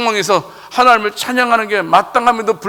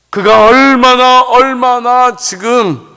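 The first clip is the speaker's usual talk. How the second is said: stretched slow and loud, pressing each word; slow, loud preaching stress; fervent emphasis; 4 semitones higher, 6 dB louder, much slower than usual. Acted.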